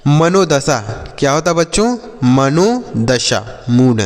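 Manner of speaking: usual speaking voice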